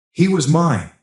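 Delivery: high-pitched, emphatic claim; anguished exclamation. A narrator's reading. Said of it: "she exclaimed, pounding the wall".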